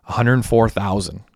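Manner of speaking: usual speaking voice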